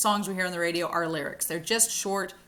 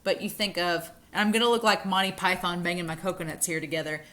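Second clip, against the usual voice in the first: ordinary delivery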